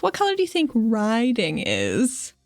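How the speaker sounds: normal talking voice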